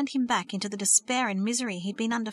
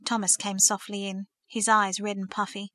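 ordinary delivery

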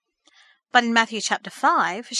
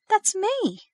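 much higher, startled recognition; gasped; 11 semitones higher than usual. A narrator's reading. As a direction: gasp it out in surprise. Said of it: "she gasped".

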